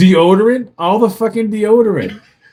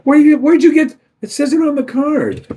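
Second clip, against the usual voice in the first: flustered high dad; 9 semitones higher than usual. Retold or imitated. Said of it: "I'm like".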